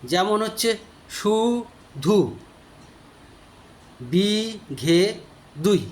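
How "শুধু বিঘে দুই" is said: শুধু বিঘে দুই drawn out slowly, each syllable separate; slow syllable count. Shown as a demonstration. Teacher slowing the poem line to count beats, slow. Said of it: "এটা কত কত মাত্রা আছে একটু দেখে নেওয়া যাক".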